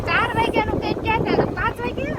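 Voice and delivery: mock-scolding the latecomer in a squeaky high voice; high mock teasing. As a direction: pipe up in a high sing-song to tease the latecomer. Acted quarrel, teasing latecomer.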